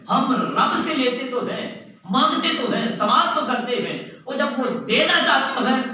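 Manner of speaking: high, forceful rebuke to the congregation; impassioned preaching. Real, not acted. (پرجوش واعظانہ انداز، اونچی آواز)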